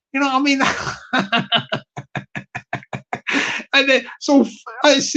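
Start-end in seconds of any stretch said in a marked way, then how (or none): none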